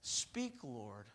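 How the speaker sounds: hushed, low and soft; a soft murmur, barely voiced; quiet instruction. Eli teaching Samuel what to say, hushed. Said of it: "you say".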